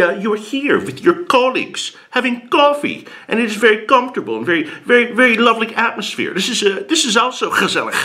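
put-on high, hurried Dutch accent full of hesitations. Imitates Dutch person defending gezellig.

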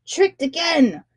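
a king fuming at the trick; raging king. A narrator's reading. Fooled shark king rages, hammed-up fury. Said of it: "the king of shark raged".

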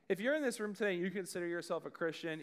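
ordinary delivery

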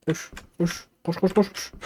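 hushed, high, urgent muttered repeats; tense, softly voiced run command; hushed urging. Real, not acted. Urging the character to run, tense play.

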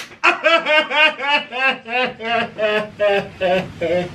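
breathy giggling laugh. Real, not acted. Laughter after teasing.

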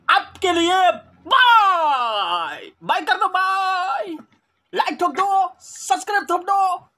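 high, loud, slow drawn-out goodbye; shouted outro. Real, not acted. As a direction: bellow the sign-off to the fans. Big shouted outro to viewers.

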